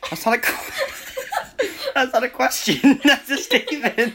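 laughing while saying it. Real, not acted. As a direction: break up laughing mid-sentence. Laughing at a fan question.

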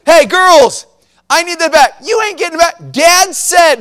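high, loud imitation of a bossy little brother; bossy calling out. Son orders his sisters with Dad's power, loudly bossy. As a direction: call out like a kid pulling rank.

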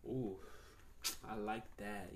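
low, soft mock flirting; quiet teasing come-on; flirty imitation. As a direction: imitate a flirty customer, voice dropped. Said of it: "in the same flirty ass way too".

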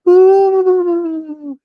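high loud ghostly ooh. Retold or imitated. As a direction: hoot like a ghost. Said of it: "sounded like somebody trying to do a ghost sound".

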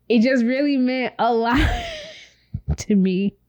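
tearful, voice cracking with emotion; real crying while thanking her partner; choking up. Real, not acted. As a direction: fight back real tears. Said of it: "Okay, I'm not crying".

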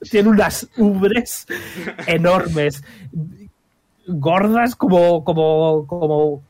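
slow, giggling through the words; laughing while describing. Real, not acted. DM describing giant cow.

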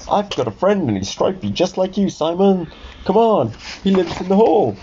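excited offer of a friend; eager character voice. Acted. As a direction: pitch it up, keen to help.

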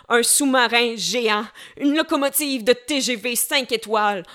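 loud, powerful spoken-word poem; poem recitation. Acted. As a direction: declaim it, building bigger and bigger. Recites her own poem.

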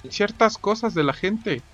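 high-pitched put-on character voice; puzzled engineer. Engineer explaining what they usually take, puzzled high voice. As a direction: play a puzzled know-it-all, pitched well up.

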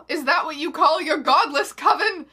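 knowing query. A narrator's reading. Dumbledore challenging the villain, loud knowing query. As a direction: demand it with pious certainty. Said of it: "Dumbledore queried knowingly".

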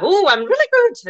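high, loud, gushing exclamation; mock-excited swoop up high; hammed-up delight. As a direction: play it over-the-top thrilled. Acting out an emphatic answer.

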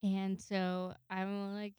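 normal talking voice

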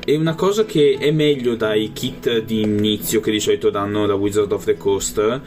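ordinary delivery

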